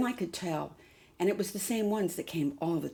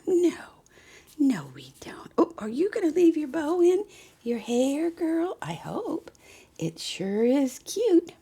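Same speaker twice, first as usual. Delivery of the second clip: put-on high, sing-song baby talk to a doll; cooing baby talk; 10 semitones higher, 8 dB louder than usual. Doll collector fussing over her doll in high baby talk.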